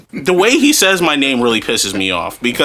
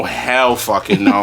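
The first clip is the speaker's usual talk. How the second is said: ordinary delivery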